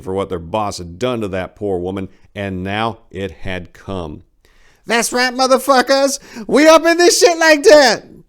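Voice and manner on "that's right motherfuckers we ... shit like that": high, loud, cocky shout; hyped-up Four-Way. A narrator's reading. Young thug bursting in, high hyped shout. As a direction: crow it at the room, high and loud. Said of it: "pronounced Four-Way as he bounded".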